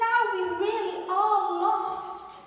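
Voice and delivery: high, loud despairing mouse; upset mouse. Acted. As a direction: wail that all is lost. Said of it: "said Mouse".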